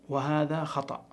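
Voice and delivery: normal talking voice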